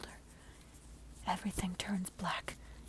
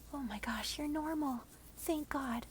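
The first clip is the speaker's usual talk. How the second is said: a touch higher, relieved hushed voice; lightly voiced relieved neighbor; relieved whisper; 5 semitones higher than usual. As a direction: neighbor relieved you are normal. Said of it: "she whispered".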